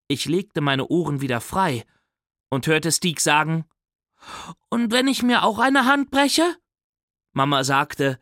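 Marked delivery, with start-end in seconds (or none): 4.7-6.6 hoch und hoffnungsvoll fragend; hohe Kinderstimme. Acted. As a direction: ein kleiner Junge, der auch einen Gips will. Fünfjähriger fragt Mama. (der kleine Bruder fragt Mama mit hoher Kinderstimme)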